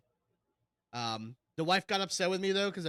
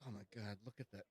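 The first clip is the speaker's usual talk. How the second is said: hushed mock awe; 7 semitones lower, 12 dB quieter than usual. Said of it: "You're like".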